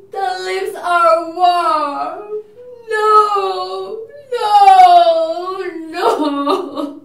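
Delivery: crying out loud and high through sobs; anguished sobbing. Acted. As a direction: wail it over the dead lover. Juliet weeping over dead Romeo.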